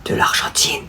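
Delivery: whispered, barely voiced confession; timid admission. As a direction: whisper it as if afraid to be heard. Afraid to admit the shirt. (aveu chuchoté, craintif)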